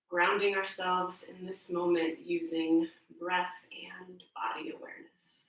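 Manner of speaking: soft, low meditation voice with long pauses; hushed check-in, fading almost to a whisper; guided meditation. Real, not acted. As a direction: ease them quietly into body awareness. Leads a grounding meditation.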